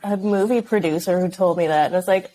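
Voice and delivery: ordinary delivery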